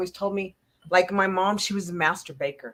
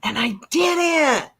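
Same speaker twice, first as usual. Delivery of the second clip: slow, loud and high, voice breaking; a loud, slow cry of confession; tearful admission; 8 semitones higher, 5 dB louder, slower than usual. Real, not acted. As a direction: stretch it out through tears. Crying admission.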